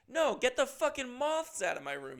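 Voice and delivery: high, loud furious shout; angry yell. Acted. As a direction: blow up at the hotel. Outburst at the moth sign.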